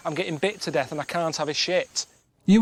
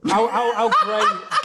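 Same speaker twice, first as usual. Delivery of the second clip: laughing disbelief; 6 semitones higher, 4 dB louder, much slower than usual. Real, not acted. Incredulous laughing question.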